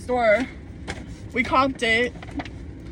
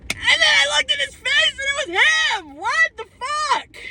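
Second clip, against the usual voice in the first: squealed in a very high, strained voice; high-pitched squeal. Real, not acted. Telling viewers it really was the ex, high-pitched squeal. Recounting the shock of recognising ex.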